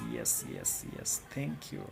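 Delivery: ordinary talk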